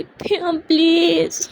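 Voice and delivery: slow, drawn-out plea; pleading Shruti. Acted. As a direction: stretch out the please, begging her to stop. Shruti begging Priya to stop.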